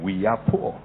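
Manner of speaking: low, soft imitation of mother's conviction. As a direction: quote mother gravely, with quiet conviction. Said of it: "she said it with a lot of conviction".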